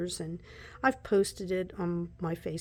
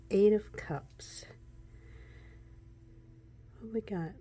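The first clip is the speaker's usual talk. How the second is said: whispered. Real, not acted. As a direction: lean in and whisper. Whispers the card name.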